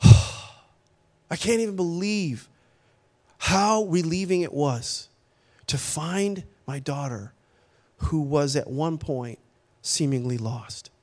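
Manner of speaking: slow, sighing relief, falling away; heartfelt relief. Retold or imitated. Father recalling finding his lost daughter, slow relief. Reliving relief after a sigh.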